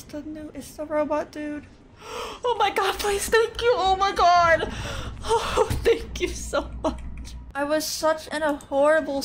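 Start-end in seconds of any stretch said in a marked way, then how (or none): 2.4-7.0 relieved laughing thanks. Real, not acted. Teammate rescues her.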